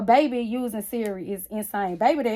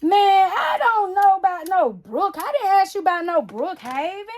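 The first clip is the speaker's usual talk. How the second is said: put-on child annoyed; 8 semitones higher, 6 dB louder than usual. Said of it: "she said".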